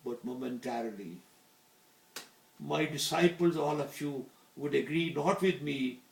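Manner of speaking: measured, emphatic poetry reading; poem recital. Acted. Poet reciting a Buddha poem to listeners, measured recital. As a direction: declaim the verse line by line, pausing between phrases.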